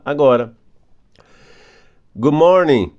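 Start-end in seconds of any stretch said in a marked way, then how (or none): 2.2-2.9 "Good morning." with the D dropped before the M; linked pronunciation. Shown as a demonstration. Teacher showing students how "Good morning." links. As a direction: say "Good morning." without the D sound. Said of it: "Eu não leio o D por causa do M".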